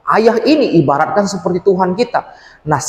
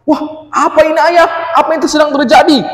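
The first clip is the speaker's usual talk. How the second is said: put-on high, fast, frightened child asking; a boy startled as the ground shakes; panicked child; 12 semitones higher, 4 dB louder than usual. (anak panik bertanya dengan suara tinggi dan cepat)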